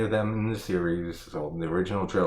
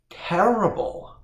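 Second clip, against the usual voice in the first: disgusted echo; 11 semitones higher, 3 dB louder, slower than usual. Real, not acted. Repeating verdict in disbelief.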